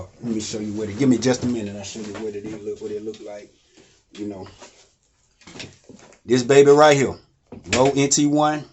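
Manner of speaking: ordinary delivery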